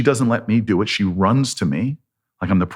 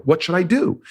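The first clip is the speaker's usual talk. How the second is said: high-pitched, hopeful question; excited; 8 semitones higher than usual. Retold or imitated. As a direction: ask eagerly, pinning hopes on the answer. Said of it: "I'm like excited".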